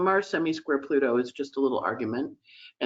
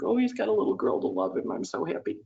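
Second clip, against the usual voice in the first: tearful happiness; 7 semitones higher than usual. Retold or imitated. Speaker hearing Ted was adopted by a little girl, tearfully happy. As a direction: choke up with happy tears. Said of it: "I really cried then".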